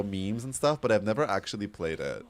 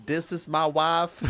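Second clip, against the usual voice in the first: high, slow Western twang; cowboy Western accent; 6 semitones higher, slower than usual. Acted. Streamer playing a cowboy introducing a wife, Western accent. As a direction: drawl it in a put-on Western accent. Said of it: "Cowboy would be more Western".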